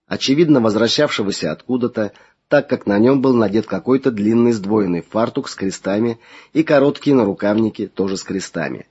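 plain reading voice

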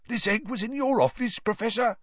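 high, quick startled question; Filch surprised. A narrator's reading. Filch asking Snape, high and startled. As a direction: blurt it in surprise.

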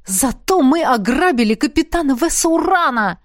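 enthusiastic, proud announcement; delighted exclamation. A narrator's reading. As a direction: burst out with rapture. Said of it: "восторженно воскликнул Дин".

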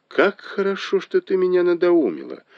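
very high, elated voice; delighted exclamation. A narrator's reading. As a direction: exclaim with relief, pitch soaring. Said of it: "воскликнула Фэнзя".

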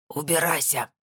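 strangled, rasping order; choked, hoarse dismissal; hoarse croak. A narrator's reading. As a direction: croak it out as if held by the throat. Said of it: "прохрипел Томашевский".

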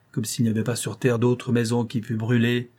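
ordinary delivery, nothing marked

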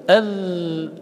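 sustained, steady ذ at the end of أذ; flowing dhal demo. Shown as a demonstration. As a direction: hold the ذ of أذ in a long voiced buzz. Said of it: "رخوة، بمعنى أن، أن الصوت يجري".

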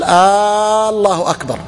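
slow "آه الله أكبر" with the opening hamza stretched into a long question-like آه; drawn-out hamza. Shown as a demonstration. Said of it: "إذا مد همزة الله أكبر".

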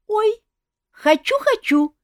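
squealing high and loud with glee; gleeful. Acted. Olga squeals at the king's threat, excited high squeal. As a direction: clap and squeal like an excited girl. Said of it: "захлопала в ладоши".

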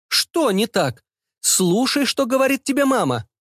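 high, insistent motherly rebuke; bossy mother. Acted. Mother demanding her son listen to her, bossy.

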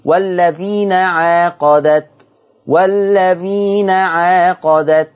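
والذين عاقدت recited slowly with a long aa in عاقدت; natural two-count madd. Shown as a demonstration. Teacher recites the Warsh verse slowly for students. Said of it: "مد طبيعي يمد بمقدار حركتين".

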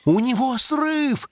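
high, slow horrified cry; terrified shout. A narrator's reading. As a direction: cry out in horror. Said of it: "в ужасе закричал ёжик".

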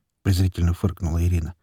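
usual speaking voice

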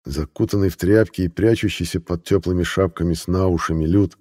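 ordinary delivery, nothing marked

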